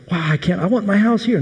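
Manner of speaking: a high, eager cry of delight; excited spies. Retold or imitated. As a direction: gush like you've just found your dream home. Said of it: "and they were so excited".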